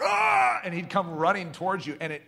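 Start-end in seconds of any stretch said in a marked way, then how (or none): 0.0-0.6 high short shout like a charging goalie; goalie yell. Retold or imitated. Preacher imitating the charging goalie, sudden high shout. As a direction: bark it like an intimidating keeper. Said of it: "He'd be like".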